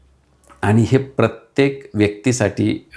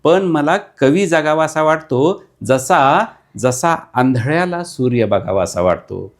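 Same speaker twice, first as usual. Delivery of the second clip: emphatic recital; 4 semitones higher than usual. Acted. Repeats quote with emphasis.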